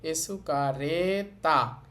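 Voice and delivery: এসুকারেতা slowly, with the vowel before তা stretched; drawn-out vowel. Shown as a demonstration. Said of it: "এরপর একটু টেনে বলতে হবে".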